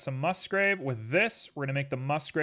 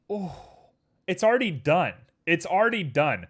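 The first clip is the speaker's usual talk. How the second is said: higher and louder, amazed; surprised; 4 semitones higher, 5 dB louder than usual. Real, not acted. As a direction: gasp it out amazed. Surprised it is finished.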